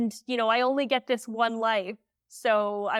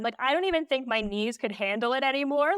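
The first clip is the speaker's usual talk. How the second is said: usual speaking voice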